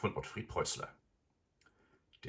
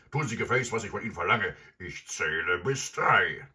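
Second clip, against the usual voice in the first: loud robber ultimatum; shouted threat; 4 semitones higher, 9 dB louder than usual. A narrator's reading. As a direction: bellow the order, slowing on the final threat. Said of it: "rief der Räuber Hotzenplotz".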